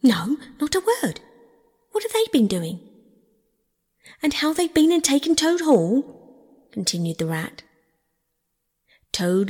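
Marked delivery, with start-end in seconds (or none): 0.0-2.8 raised, anxious reply; trembling alarm. A narrator's reading. Toad asks Rat what the enemy did, frightened. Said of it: "trembling in his every limb". 4.2-6.7 high, indignant exclamation; agitated news-breaking. A narrator's reading. Rat tells Toad his home is taken, agitated.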